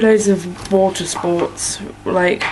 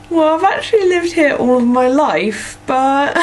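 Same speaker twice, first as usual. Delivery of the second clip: high-pitched put-on American accent; higher, amateur American accent; imitated American accent; 8 semitones higher than usual. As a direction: retell it in a mock American accent, about to laugh. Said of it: "started talking an American accent to her".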